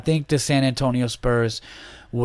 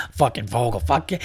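imitating a friend. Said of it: "do impressions".

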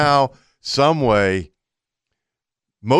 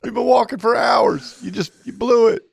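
high, raised-voice retold telling-off; mock-exasperated outburst, pitched up; exasperated scolding; 9 semitones higher than usual. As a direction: call off the hunt, fed up. Retold scolding after spoiled hog hunt.

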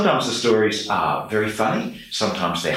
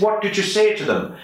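high-pitched Irish priest; Donal, Irish accent; 11 semitones higher than usual. Retold or imitated. Old parish priest questioning his new deacon in an Irish accent. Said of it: "I'm sorry to do the accent".

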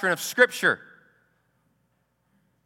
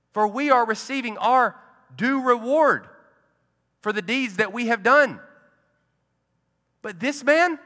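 rebuking; 6 semitones higher than usual. Retold or imitated. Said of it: "the other rebuked him".

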